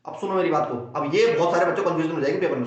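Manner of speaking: ordinary talk, nothing marked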